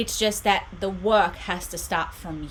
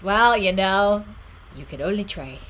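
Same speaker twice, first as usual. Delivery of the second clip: put-on Irish accent; 6 dB louder than usual. Streamer answering a chat joke with a bad Irish accent. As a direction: ham up an Irish accent and fail. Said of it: "That was even worse".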